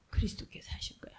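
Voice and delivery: a quick hushed whisper. Shown as a demonstration. Preacher repeats the answer to listeners, hushed whisper. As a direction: whisper it like a secret truth. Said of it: "whispered answer repeated".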